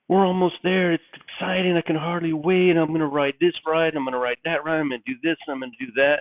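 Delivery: put-on high excited kid, easing down as the list runs on; enthusiastic eight-year-old. Eight-year-old in the car listing rides, enthusiastic. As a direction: gush like a child who can hardly wait. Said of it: "he's so enthusiastic".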